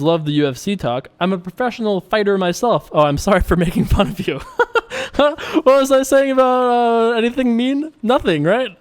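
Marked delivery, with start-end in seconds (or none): 4.4-8.8 laughing. Real, not acted. Laughs at own teasing.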